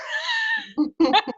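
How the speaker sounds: a bright, high burst of laughter. Real, not acted. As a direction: laugh out, amused at herself. Laughs at labour starting.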